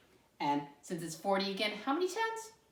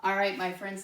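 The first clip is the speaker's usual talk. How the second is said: normal talking voice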